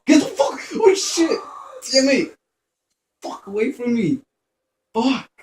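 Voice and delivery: high, loud terrified yells; panicked screaming. Real, not acted. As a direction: scream in real panic as it grabs you. Monster attacks him.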